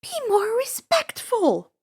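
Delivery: high, whispered scolding hiss; hissed warning. A narrator's reading. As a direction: scold him under your breath. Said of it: "Annabeth warned".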